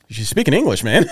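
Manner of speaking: retold exasperation. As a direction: insist on it as if it were obvious. Retold exasperated reply to visitor.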